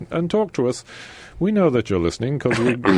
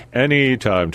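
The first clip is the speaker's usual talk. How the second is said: usual speaking voice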